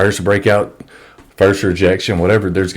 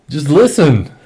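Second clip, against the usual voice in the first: pleading, pitched up and louder; exasperated plea; 7 semitones higher, 4 dB louder than usual. Real, not acted. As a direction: beg them to just listen. Frustrated plea to followers.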